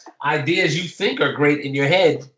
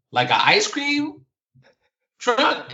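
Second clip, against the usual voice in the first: drawn out, much higher, baffled; slow incredulous question; 11 semitones higher, slower than usual. Retold or imitated. Teacher guessing at the stolen van, slow and high in disbelief. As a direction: stretch it slowly, high with disbelief.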